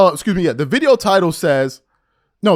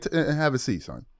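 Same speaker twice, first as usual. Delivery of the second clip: ordinary delivery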